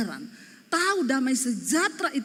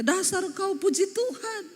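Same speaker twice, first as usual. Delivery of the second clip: pura-pura ribut; 7 semitones higher than usual. Pretend. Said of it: "Pasti kita kalau ribut ngomongnya".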